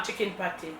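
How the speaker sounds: ordinary delivery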